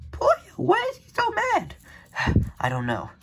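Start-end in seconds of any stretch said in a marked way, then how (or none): 0.1-1.8 very high, louder put-on voice; cartoon sidekick squeaking in alarm; squeaky character voice. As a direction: pitch it way up like a cartoon kid. Put-on voice reacting.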